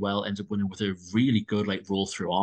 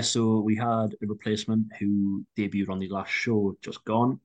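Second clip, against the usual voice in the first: ordinary talk, nothing marked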